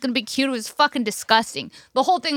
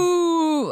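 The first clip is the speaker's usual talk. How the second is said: cow moo; 4 semitones higher than usual. Shown as a demonstration. A cow call to win the moo contest. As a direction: bellow it like a cow. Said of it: "That was a moo".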